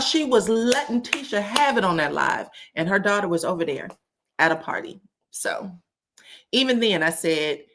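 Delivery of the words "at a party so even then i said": normal talking voice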